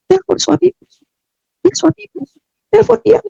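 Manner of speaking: high, urging inner voice; a voice in her heart pressing her. Retold or imitated. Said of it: "seperti ada suara yang bicara". (suara hati yang mendesak, nada tinggi)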